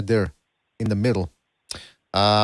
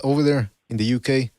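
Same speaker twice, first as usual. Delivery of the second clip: usual speaking voice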